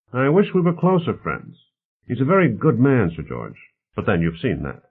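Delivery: lively, mildly voiced character dialogue; affable host Bonner. Bonner talks politics with his guests, lightly voiced affable host.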